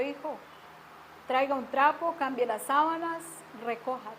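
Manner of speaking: soft, composed instructions; quiet, restrained request; retold calm mother. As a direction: tell a child gently, without any yelling. Reformed mother calmly instructs son.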